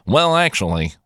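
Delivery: a hint of know-it-all Professor Frink; nerd voice. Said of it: "I'm trying to do like a Professor Frink, but not really".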